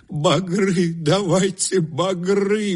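high, slow moaning plea. A narrator's reading. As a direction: groan the words out, high and stretched. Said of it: "простонал старик". (старик стонет высоко и протяжно)

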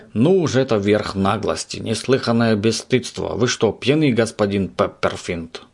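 usual speaking voice

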